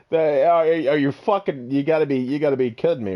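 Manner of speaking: normal talking voice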